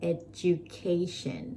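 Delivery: education drawn out very slowly with ca stressed; slow teaching demo of education. Shown as a demonstration. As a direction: stretch education out, leaning on ca. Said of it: "stress อยู่ตรงที่".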